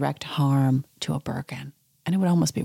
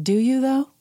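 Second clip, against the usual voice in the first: higher, pointed doubting question; skeptical retort; 8 semitones higher, 3 dB louder than usual. Retold or imitated. Narrator doubting Kyle's sympathy, higher and pointed. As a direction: push back, pitched up and louder. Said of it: "And I'm like".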